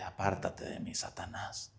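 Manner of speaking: whispered rebuke, low and hushed; a hushed, grave command; stern whisper. Acted. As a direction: hiss the rebuke under the breath. Jesus rebukes Peter.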